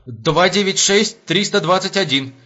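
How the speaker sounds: high, slow hesitant recital; frightened. A narrator's reading. Frightened sentry recites a phone number to the major, hesitant. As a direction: falter through the digits, nervous and high. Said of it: "перепуганный караульный замешкался".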